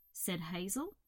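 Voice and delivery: ordinary delivery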